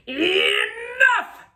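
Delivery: loud, high royal shout of enough; high angry king shouting; king's shout. Acted. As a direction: bellow it like a fed-up king. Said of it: "he said".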